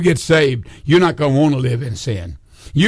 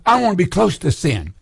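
usual speaking voice

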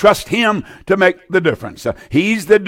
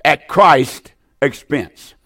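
very slow, each word weighed; slow, deliberate teaching delivery; slow, spelled-out definition; much slower than usual. Shown as a demonstration. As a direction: dictate it slowly so they can note it. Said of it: "now listen".

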